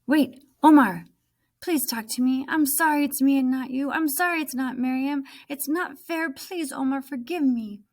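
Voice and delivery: high, urgent pleading. Acted. Nemo begging Omar to talk to her, pleading. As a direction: beg him to forgive you.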